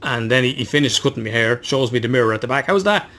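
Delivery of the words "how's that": higher, louder voiced barber's question; chirpy barber. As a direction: show off the haircut brightly, pitched up. Acts out the barber asking.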